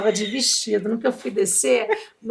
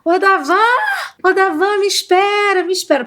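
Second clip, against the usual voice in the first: shouting to the van driver; 8 semitones higher than usual. Retold or imitated. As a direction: call out for the van to wait. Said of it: "comecei a gritar". (gritando pro motorista da van esperar)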